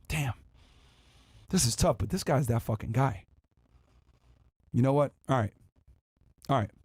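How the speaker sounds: put-on low, deflated girl listing rules, long pauses; dismayed girl reading rules. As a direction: mutter each rule like bad news.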